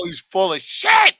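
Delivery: loud, high, slow outburst; incredulous shout. Real, not acted. Host shouting that the guest is lying about his marriage. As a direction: shout out your disbelief at the claim. Reacting to a surprising claim.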